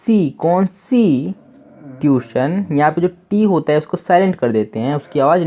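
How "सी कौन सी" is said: कौन सी drawn out slowly, mock mistake; slow demo of the wrong reading; common wrong reading. Shown as a demonstration. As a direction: sound out कौन सी as learners misread it. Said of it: "इसे ज़्यादातर लोग क्या पढ़ते हैं".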